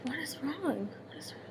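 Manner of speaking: very soft, worried questioning; hushed, retold concern; imitated concerned mom. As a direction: voice a worried mother, fading almost to nothing. Worried mother voiced as daughter cries.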